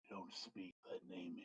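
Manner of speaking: low, soft menacing dragon; quiet ancient dragon warning. Acted. Dragon warns the party.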